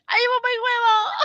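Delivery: very high silly witch whine; put-on high-pitched witch voice; weird witchy voice. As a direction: wail it like a cartoon witch. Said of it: "doing these really weird voices".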